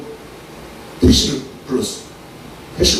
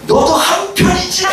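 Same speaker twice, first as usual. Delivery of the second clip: very high, drawn-out shout; slow, strained acted yell; angry accusation; 13 semitones higher, slower than usual. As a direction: scream the accusation like an angry drunk. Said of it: "그러면서 막 화가 나니까".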